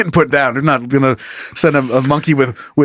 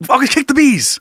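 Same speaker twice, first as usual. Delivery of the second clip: tag; 8 semitones higher than usual. Acted. Acts out bee owner's sudden idea.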